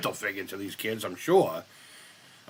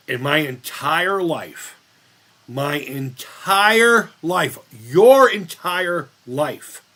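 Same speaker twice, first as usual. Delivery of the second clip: slow, each "entire life" louder; emphatic building; 2 semitones higher, 6 dB louder, much slower than usual. Real, not acted. Streamer ranting to viewers, slow and building louder. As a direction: lean harder on every repeat. Hammering home his argument.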